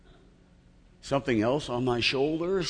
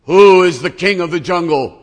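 even louder, higher lion boast; loud cocky lion demand; 6 semitones higher, 15 dB louder than usual. Acted. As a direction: bellow it at the elephant. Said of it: "The lion says".

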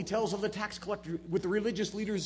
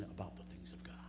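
whisper; 11 semitones lower, 15 dB quieter than usual. Acted. Preacher drops to confiding whisper.

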